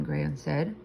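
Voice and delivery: ordinary delivery, nothing marked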